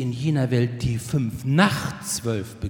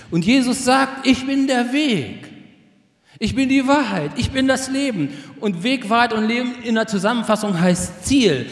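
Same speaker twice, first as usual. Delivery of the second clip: high, raised, fervent sermon climax; forceful preaching; 9 semitones higher, 5 dB louder than usual. Real, not acted. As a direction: proclaim the promise at full pulpit force. Predigt mit voller Kraft. (mit Inbrunst und erhobener Stimme gepredigt)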